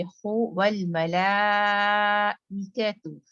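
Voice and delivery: very slow मलाइकतु with a long ला; drawn recitation; stretched la. Shown as a demonstration. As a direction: pull out the ला in मलाइकतु. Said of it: "ला को खींचे".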